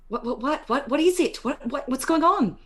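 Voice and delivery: high, stammered questions; alarmed questioning. Acted. Corey asking Caleb what is going on, high and alarmed. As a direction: pitch up, stumbling over the repeated word. Corey reacts to frightened companion.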